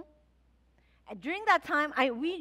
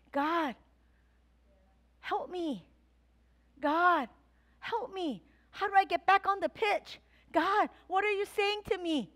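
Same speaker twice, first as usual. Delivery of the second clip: crying out to God; 5 semitones higher than usual. Retold or imitated. Pastor retelling how she cried out to God, pleading. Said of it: "I cried out".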